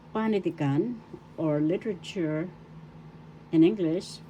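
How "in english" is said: normal talking voice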